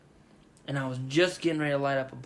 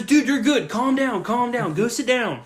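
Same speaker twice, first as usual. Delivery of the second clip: higher, louder urging to calm down; calming stranger; 9 semitones higher, 5 dB louder than usual. Retold or imitated. Stranger tells the panicking victim to calm down. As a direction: settle him down firmly. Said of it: "He goes".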